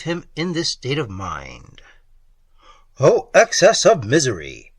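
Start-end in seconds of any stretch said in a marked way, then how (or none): 3.0-4.7 loud anguished outburst; sudden exclamation. A narrator's reading. A remorseful lover exclaiming in anguish, loud. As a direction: burst out in misery. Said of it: "he suddenly exclaimed".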